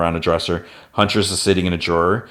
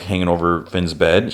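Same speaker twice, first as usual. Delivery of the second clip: usual speaking voice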